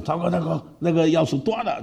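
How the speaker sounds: high, alarmed voice; dismayed. Retold or imitated. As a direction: caught off guard by the broken key. Classmate dismayed at broken key. (惊慌地说)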